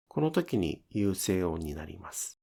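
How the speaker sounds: ordinary delivery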